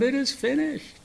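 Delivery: high cry, volume held back. Acted. Preacher imagines Jesus's final words, high restrained cry. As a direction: cry out high without full force. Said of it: "Crying out".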